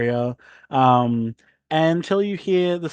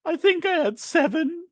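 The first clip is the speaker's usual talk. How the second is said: much higher, small unsure reply; mild duck voice. Acted. Mildred answering the landlord in a high, unsure voice.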